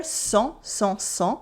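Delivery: "sang" with nasal ah, silent NG; nasal vowel. Shown as a demonstration. Teacher demonstrating nasal sounds, "sang" on nasal ah. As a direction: say "sang" through the nose, French sahn without the N. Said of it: "vowel sounds which are nasal".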